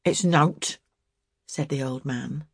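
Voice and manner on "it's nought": put-on old man's mild protest; dismissive protest; vain protest. A narrator's reading. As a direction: wave it away, protesting in vain. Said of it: "vainly protesting".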